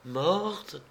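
a touch of tears, soft; girl repeating the word in tears; tearful repeat. A narrator's reading. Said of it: "répéta Sainte en pleurant".

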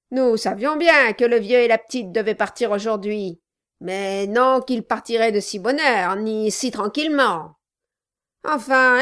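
loud, gruff dwarf. A narrator's reading. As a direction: bark it like a surly dwarf. Dwarf with authority.

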